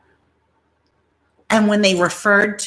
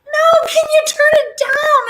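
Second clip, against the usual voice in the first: high pleading shout; 6 dB louder than usual. Retold or imitated. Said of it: "we'd be like".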